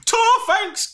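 high, clipped thanks; curt Barry voice. Retold or imitated.

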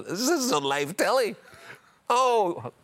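high-pitched mock thrill; gleeful high exclamation; retold glee. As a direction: squeal with mischief, pitched right up. Retold glee while trash talking.

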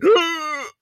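a big high gasp, sucking air in; sharp inhaled gasp. Shown as a demonstration. Demonstrating the Yorkshire breathing-in trick with a gasp. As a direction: gasp in hard again to show it. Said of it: "Like that, see?".

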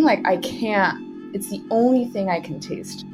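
ordinary talk

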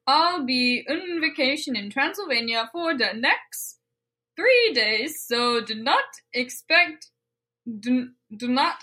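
lilting mock-Swedish; Swedish Chef accent. Reading a holiday note aloud, mock-Swedish accent. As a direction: stumble through it in a bad Swedish accent. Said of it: "in the accent of Swedish Chef".

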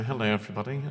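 lightly put-on English accent, low and soft. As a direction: Scot trying a quiet English accent. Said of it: "I'm doing your accent now".

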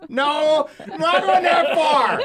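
laughing it off, high and loud; laughing protest. Real, not acted. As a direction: protest through real laughter. Laughing at accent teasing.

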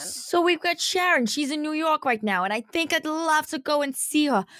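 chatty, improvised New York accent; exaggerated New Yorker patter. Shown as a demonstration. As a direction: ham up a New York accent and keep rambling. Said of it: "do a New York accent".

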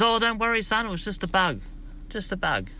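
high put-on mum voice, brushing it off; mother reassuring her son. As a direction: soothe it away like a nervous mum. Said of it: "She goes".

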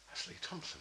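high, hushed whisper of recognition; awed whisper to himself. Retold or imitated. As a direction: breathe it like a secret discovery. Recognising a hero in secret.

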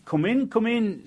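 high, slow, coaxing invitation; eager welcome. Acted. Woman of the house welcoming the old woman in from the rain, high and slow. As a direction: draw them in kindly from the rain.

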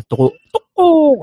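high, slow gecko call. Retold or imitated. Ramos hiding in tree from soldiers, mock gecko call. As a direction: croak out tuko like the lizard.

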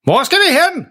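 meget lyst spillet råb; råb spørgsmålet som halvdøv; halvdøv, råbende far. Acted. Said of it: "holder langsomt op med at råbe". (spillet råb)

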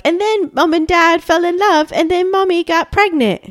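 high-pitched child's play-acting voice; little kids narrating. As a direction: imitate a small child putting on a play. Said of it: "and they're like".

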